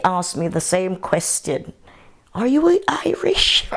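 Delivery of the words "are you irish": high, mock-curious neighbour asking; imitating neighbours. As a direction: pitch it up like the puzzled locals. Neighbours' question retold.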